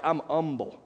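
"humble" said without its H, as "umble"; "humble" starting straight on the vowel, mock-modest; dropped H. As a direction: drop the opening H of "humble". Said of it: "they don't even pronounce the H".

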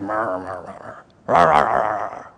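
low, soft rumbling murmur; crowd murmur. Shown as a demonstration. Preacher imitating grumbling people, low murmuring rumble. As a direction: make the dull roar of a grumbling crowd. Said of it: "a lot of people murmuring".

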